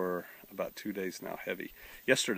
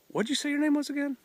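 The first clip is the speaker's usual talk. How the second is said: much higher, friendly mock greeting; young woman's voice; 4 dB louder than usual. Young woman greeting the pastor, imitated high and friendly. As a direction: lightly imitate a cheerful college girl.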